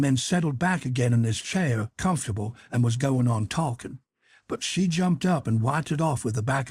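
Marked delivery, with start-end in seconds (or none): none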